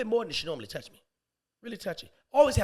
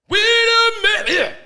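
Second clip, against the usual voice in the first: very high, drawn-out loud cry; mock wailing outburst; 16 dB louder, much slower than usual. Man reacting to her no-strings offer, mock wail. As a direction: wail it out, stretching the words.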